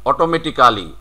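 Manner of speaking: "অটোমেটিক্যালি" with a full "kaa" before "li"; every syllable of "অটোমেটিক্যালি" sounded; local "অটোমেটিক্যালি". Shown as a demonstration. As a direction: say "অটোমেটিক্যালি" as oto-meti-KAA-li. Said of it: "এই যে সি এ রয়েছে".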